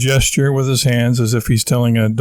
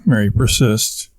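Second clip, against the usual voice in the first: usual speaking voice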